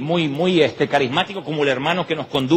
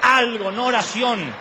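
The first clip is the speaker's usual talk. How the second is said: mock outrage; 8 semitones higher than usual. Comic rant about wanting money.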